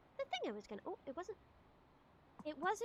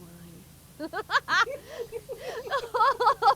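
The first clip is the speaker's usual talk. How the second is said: loud high-pitched cackle; loud high laugh; 9 semitones higher, 8 dB louder than usual. Real, not acted. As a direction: burst out laughing. Big laugh.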